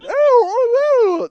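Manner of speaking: very high, loud whale song; whale call imitation. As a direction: wail long and high like whales talking. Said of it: "where they have whales talking".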